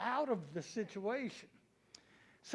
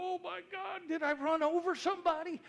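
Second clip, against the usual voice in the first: panicked; 8 semitones higher, 3 dB louder, faster than usual. Retold or imitated. Panic while backing down hill.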